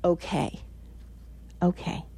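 quiet reassuring okay; soft okay. Retold or imitated. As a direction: say it softly, settling. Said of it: "she actually writes".

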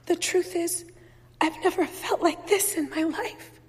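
high, mildly tearful confession; weeping. A narrator's reading. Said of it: "I say, weeping".

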